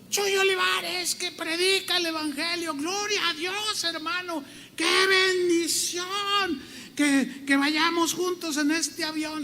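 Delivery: very high, gushing woman's cry with stretched words; señora gritona. Retold or imitated. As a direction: imitate an overexcited church lady calling out. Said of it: "una señora a la mitad del avión empieza a gritar". (imitando a una señora que grita emocionada, voz muy aguda)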